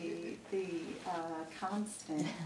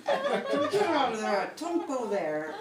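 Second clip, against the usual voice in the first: loud, higher yell broken by laughter; laughing yell; 7 semitones higher, 9 dB louder than usual. Retold or imitated. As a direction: laughingly shout at the kids to get out. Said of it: "as I'm screaming".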